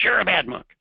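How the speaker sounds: high, loud mock accusation; angry monk. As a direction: burst out like a fuming monk in a tale. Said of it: "The other monk is angry".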